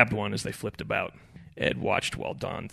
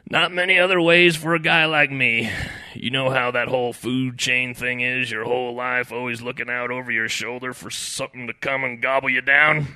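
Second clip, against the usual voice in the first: loud, gravel-tinged philosophizing; pontificating large shrimp; 3 semitones higher, 7 dB louder than usual. A narrator's reading. Doomed shrimp lectures Ed on food chains, pontificating. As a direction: hold forth like a weathered old hand. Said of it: "the large shrimp pontificated".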